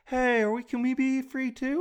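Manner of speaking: put-on high, small pleading child-like voice; timid Canada. Canada asking England for freedom too, high meek voice. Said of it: "Canada was on top, like".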